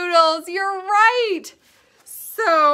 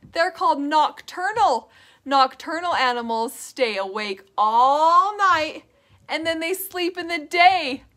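normal talking voice

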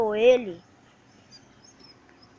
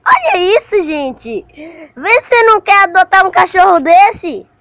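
gushing excitedly; 7 semitones higher, 12 dB louder than usual. Real, not acted. Gushing to viewers about the dog.

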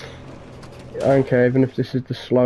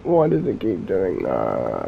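much lower, softer, slow; defeated groan; 7 semitones lower, 4 dB quieter, slower than usual. Real, not acted. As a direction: sink into weary defeat. Deflated after failing.

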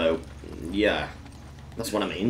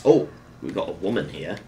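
ordinary talk, nothing marked

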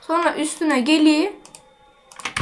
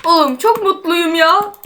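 normal talking voice

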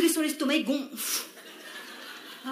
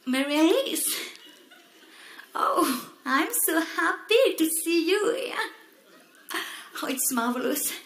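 put-on gushing English accent; 6 semitones higher, slower than usual. Fan gushing with fake English.

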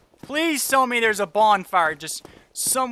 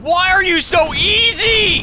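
high, slow drawn-out taunt; slow mocking shout; 10 semitones higher, 8 dB louder, slower than usual. Real, not acted. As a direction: stretch it out, yelling. Repeated, slower and higher.

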